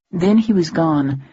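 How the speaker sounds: ordinary delivery, nothing marked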